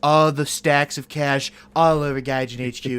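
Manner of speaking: lightly voiced Trump bluster; a hint of Trump showmanship; Trump impression. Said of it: "I'm gonna do the Trump impression".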